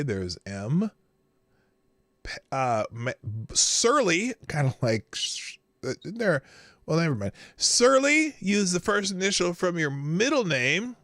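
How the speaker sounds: ordinary talk, nothing marked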